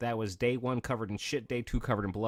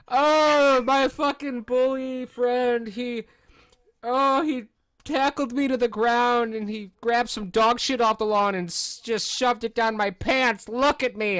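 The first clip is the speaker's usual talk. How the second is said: much higher, louder, slow mock-whining kid voice; whiny kid lie; 13 semitones higher, 7 dB louder, slower than usual. Boy lying to his friend's dad about the bully, high mock whine. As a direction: play the pitiful kid spinning a lie.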